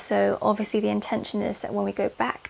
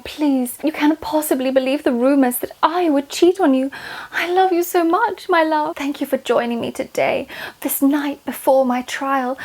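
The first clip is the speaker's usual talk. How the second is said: pleading queen voice, higher and louder; Anne Boleyn; 4 semitones higher, 7 dB louder than usual. Acted. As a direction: beg your king for mercy. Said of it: "Anne Boleyn, but with blonde hair".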